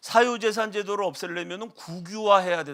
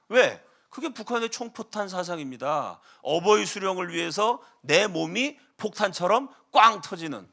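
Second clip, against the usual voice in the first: ordinary talk, nothing marked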